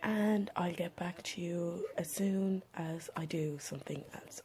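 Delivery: slow, breathy whisper to the camera. Real, not acted. Vlogger whispering a morning update to viewers. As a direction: keep it hushed and slow.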